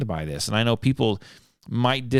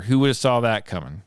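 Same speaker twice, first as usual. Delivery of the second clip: ordinary talk, nothing marked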